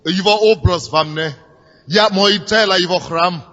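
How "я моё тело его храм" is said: ordinary talk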